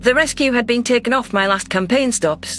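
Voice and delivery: ordinary delivery, nothing marked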